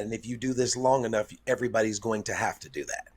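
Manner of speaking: usual speaking voice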